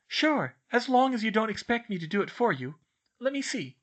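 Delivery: put-on high, firm but helpful girl; agreeable bookish girl. A narrator's reading. Girl agrees to help with homework, firm but willing. Voices Hermione in story.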